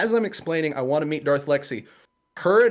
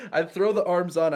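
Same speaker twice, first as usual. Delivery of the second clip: ordinary delivery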